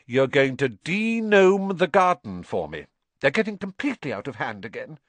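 a mild stern mum giving orders; Mrs Weasley scolding. A narrator's reading. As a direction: lay down the law to lazy sons.

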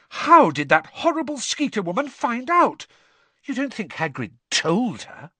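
shocked Hermione. Acted. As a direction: gasp it out in dismay. Hermione shocked, voiced high.

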